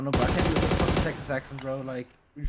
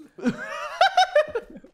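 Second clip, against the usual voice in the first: laugh; 7 dB louder than usual. Real, not acted. Laughs after his impression.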